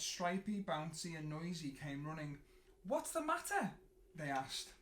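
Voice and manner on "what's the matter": high, louder worried question; concerned friends. A narrator's reading. The Munster friends asking crying Snuggly, high worried. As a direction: ask with high worried voices.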